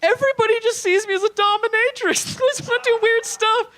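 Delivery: high, loud whiny sob-voice; mock crying woman. Dominatrix crying to the comic about finding a nice man, in a high loud hammed-up wail. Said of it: "she bursts into tears".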